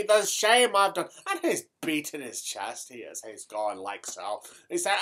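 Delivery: thick stage Irish brogue throughout. Acted. Comic Irish character telling a tale to the audience in a brogue. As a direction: keep the comic Irish lilt going.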